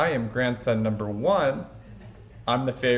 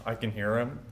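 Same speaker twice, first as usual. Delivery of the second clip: ordinary delivery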